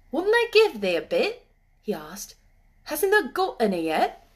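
high, loud dialect questioning; incredulous country lad; puzzled moor boy. A narrator's reading. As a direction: press her with puzzled questions, pitch swooping up. Puzzled boy questions silent girl.

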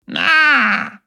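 high, loud theatrical sigh of protest; dramatic huff. Acted. Ying huffs at Yasuo for using her title, dramatic sigh. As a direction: huff it out like a put-upon old lady. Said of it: "Ying seufzte dramatisch auf".

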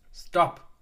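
sharp loud cry to stop; cry of pain. Acted. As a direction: yell it out, begging him to stop. Vizier cries out under blows.

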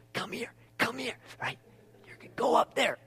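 a hint of eager ushers beckoning; lightly voiced ushers. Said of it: "they go".

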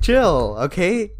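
high, loud playful plea to back off; mock exasperation. Continues the acted retort to brain.

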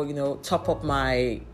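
ordinary delivery

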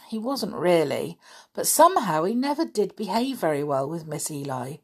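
plain reading voice

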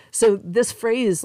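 laughing it off, slow and lifted. Real, not acted. Shaking off the memory with a laugh, laughing. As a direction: let a real laugh carry the words.

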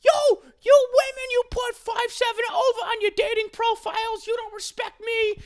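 very high, loud impression of a ranting man; mock outraged rant at women; Bagel Boss screaming. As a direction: screech it like an aggrieved Long Island guy. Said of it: "he's, like, screaming at the lady".

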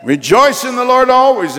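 high, loud exultant call; rejoicing cry. Real, not acted. Preacher urging the congregation to rejoice, high exultant cry. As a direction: cry the verse out to rouse the room.

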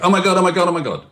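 fast, high, panicky mock fretting; panicked high pitch. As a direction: act out an anxious person spiralling. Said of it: "high pitch".

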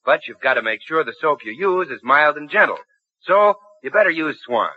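ordinary talk

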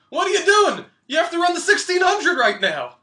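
loud, high-pitched scolding; yelling coach. Retold or imitated. Coach finds athlete eating before race, yelling. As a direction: imitate a coach hollering urgent orders.

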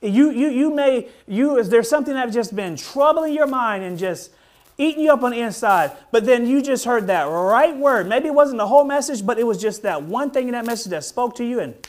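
fervent high, loud preaching. Acted. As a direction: preach it with rising fire. Preaching at full force.

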